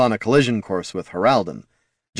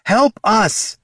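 high, urgent shout; 12 semitones higher, 4 dB louder than usual. A narrator's reading. Mage calling to companions in battle, shouted. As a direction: shout for help mid-fight. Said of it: "shouted Loruna".

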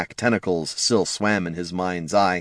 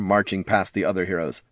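usual speaking voice

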